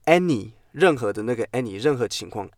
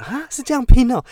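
mock surprise; 13 semitones higher, 7 dB louder than usual.

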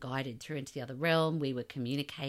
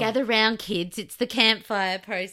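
loud, playful call to gather round; campfire storyteller; 7 semitones higher, 10 dB louder than usual. Pretend. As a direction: summon the kids to the campfire. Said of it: "This is like Lynette ghost story time".

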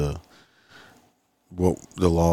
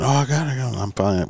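high, breezy fake-confident driver; mock driver voice; 7 semitones higher than usual. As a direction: play it off as if you've got it. Mimicking drivers pretending to search.

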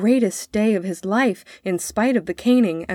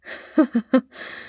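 short, higher mocking laugh; scornful laugh; 6 semitones higher than usual. A narrator's reading. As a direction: laugh dismissively at the boy. Father laughs at the boy.

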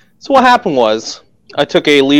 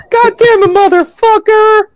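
much higher, slow squealed curse; mock squeaky rant; slower than usual. Joining the mock high rant.

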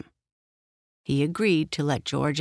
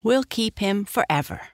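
put-on high girlish vow, falling at the end; emphatic cry; 5 semitones higher than usual. A narrator's reading. Sister promising to keep the pony forever, emphatic cry. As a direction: pitch it up, insistent child. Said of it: "cried his sister".

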